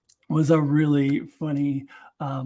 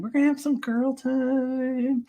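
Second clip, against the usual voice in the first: higher, slower playful character voice; girly chirp; 8 semitones higher, slower than usual. Retold or imitated. Quoting the girls planning their outing, slower playful higher voice. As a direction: sing it out like an excited friend.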